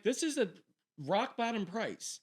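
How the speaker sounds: high, slowly drawn-out sales pitch; hammed-up bargain announcer; mock salesman pitch. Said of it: "And I'm like".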